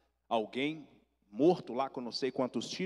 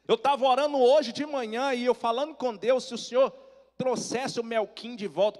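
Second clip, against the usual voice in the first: high, loud, acted-out aunt; fervent exclamation; 10 semitones higher, 7 dB louder than usual. Aunt telling nephew of her prayer, fervently exclaiming. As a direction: cry out the answered prayer.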